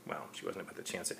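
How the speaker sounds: ordinary delivery, nothing marked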